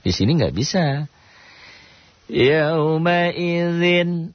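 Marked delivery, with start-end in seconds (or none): 2.3-4.3 slow, high chanted recitation; Quran recitation. Shown as a demonstration. Teacher demonstrating the verse to students, chanted slowly. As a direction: stretch the vowels like tilawah.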